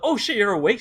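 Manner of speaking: high, loud retold surprise; surprised. Replying to her unexpected text, surprised.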